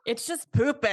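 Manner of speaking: put-on a half-hearted British accent on "poopy"; self-mocking bad British accent as a quote; terrible British accent. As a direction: try a British accent on "It's just poopy" and miss. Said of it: "My terrible British accent".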